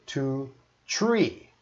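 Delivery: "tree" said with a t, no th sound; Newfoundland tree. Shown as a demonstration. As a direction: count "two, tree" like a Newfoundlander. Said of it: "I kinda learned to say one".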